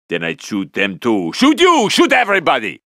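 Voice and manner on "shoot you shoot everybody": high, loud outburst; frenzied shouting. Acted. Threatening to shoot everybody, frenzied shouting. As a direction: shout it high, falling away at the end.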